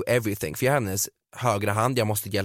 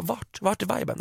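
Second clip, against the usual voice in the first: mock incredulous; 8 semitones higher, 3 dB quieter, slower than usual. Mocking reply to au pair.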